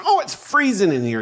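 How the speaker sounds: griping again in a put-on high whine; whiny mock complaint. Husband mocking complaints about the house, high whiny voice. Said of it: "You're in the house, go".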